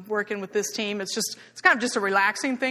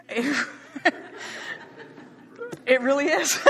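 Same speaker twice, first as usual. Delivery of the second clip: amused, laugh bubbling over the words; 7 semitones higher, 3 dB louder than usual. Real, not acted. Speaker amused telling an audience about ladies aerobics, laughing. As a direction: talk through real giggles, higher pitched.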